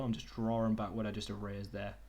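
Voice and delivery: ordinary delivery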